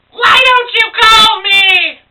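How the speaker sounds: very high, loud, drawn-out wailing cry; Syrup Man. Acted. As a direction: scream it out slowly in a shrieking character voice. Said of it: "Syrup Man cried".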